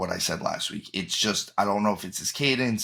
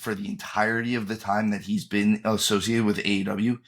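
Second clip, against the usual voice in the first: usual speaking voice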